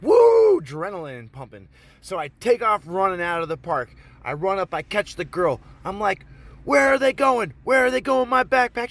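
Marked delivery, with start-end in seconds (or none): 0.0-0.6 high, loud "woo"; adrenaline whoop. Retold or imitated. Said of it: "I'm a little like". 6.6-8.9 high, loud frantic demand; acted angry shout. Confronting the girl, acted.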